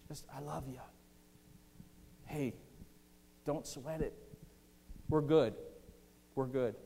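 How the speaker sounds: soft, unhurried, fond reassurance with long pauses; tender reassurance. Acted. Jesus reassuring Peter who denied him, tender reassurance. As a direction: gently let them off the hook. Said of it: "he's conveying affection to Peter".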